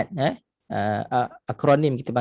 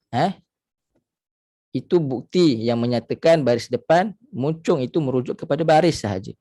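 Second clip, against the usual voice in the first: ordinary talk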